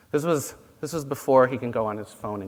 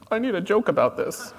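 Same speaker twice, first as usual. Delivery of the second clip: higher, laughing mock request; laughing quote; 9 semitones higher than usual. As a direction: say it through a laugh. Imagined phone user, laughing.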